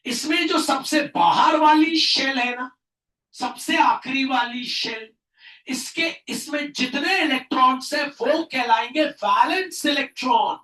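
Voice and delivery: normal talking voice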